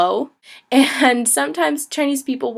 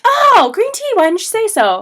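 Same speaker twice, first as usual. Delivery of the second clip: high, loud surprised realisation; light up in sudden understanding; 10 semitones higher, 5 dB louder than usual. Retold or imitated. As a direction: shopkeeper finally getting it, surprised. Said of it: "they were like".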